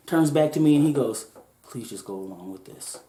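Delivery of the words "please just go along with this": barely moving his lips, hushed; murmured so only the clerk hears; low key. Retold or imitated.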